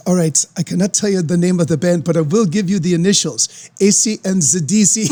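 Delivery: German lady teasing; German caller. Retold or imitated. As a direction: drag out the initials like a secret. German lady reveals initials.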